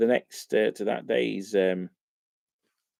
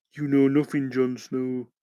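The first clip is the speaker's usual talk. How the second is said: Ygritte impression; slower than usual. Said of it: "It's almost like he's in the room".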